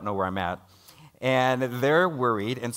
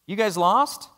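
higher, louder friendly hiker calling out; hiker's question; 10 semitones higher, 7 dB louder, slower than usual. Retold or imitated. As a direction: call out to the lost group like a passing hiker. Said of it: "and he goes".

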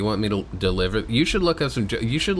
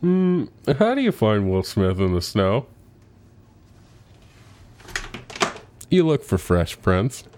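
joke voice. Pretend. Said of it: "a different voice for each of these".

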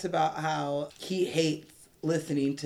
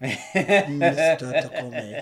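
very slow, louder villain imitation; Alan Rickman; 7 dB louder, much slower than usual. Co-host imitating the villain's weird delivery, hugely drawn out. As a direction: stretch the name out weirdly, louder. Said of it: "He says it really weird".

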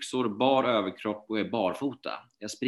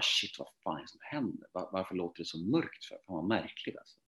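hushed bewilderment; 2 semitones lower, 9 dB quieter than usual. Retold or imitated. Retold stunned reaction to distorted sound.

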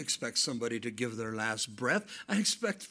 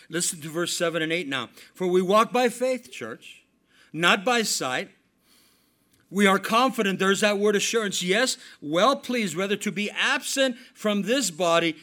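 loud, high preacher's cry, slowing on the scripture; a preacher thundering the scripture to his church; full-force preaching; 6 semitones higher, 9 dB louder than usual. Acted. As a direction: proclaim the verse to the congregation.

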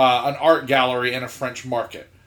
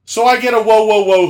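raised, loud rapid whoas; mock holler to stop; loud halting whoa; 7 semitones higher, 7 dB louder, faster than usual. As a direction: imitate a loud interruption. Retells being cut off.

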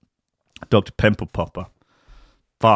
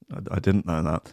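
ordinary talk, nothing marked

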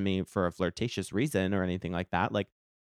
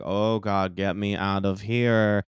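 mock dread; slower than usual. Retold dread while stuck dancing.

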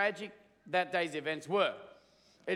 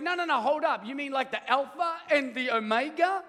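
higher, eager disbelief; pumped alien; 5 semitones higher than usual. Retold or imitated. Alien questions Michael, amazed and eager. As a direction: press him for the truth, amazed. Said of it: "the alien is just pumped".

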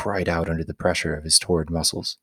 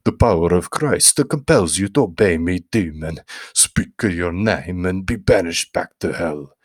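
exorcist shouting. A narrator's reading. Priest thrusting a cross at the boy, commanding shout. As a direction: cast the demon out with full authority.

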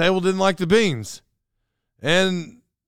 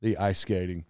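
usual speaking voice

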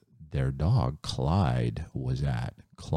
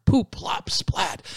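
whispered sound effect; 13 semitones higher, slower than usual. Acted. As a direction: tell it like a naughty secret. Chickens messing the house.

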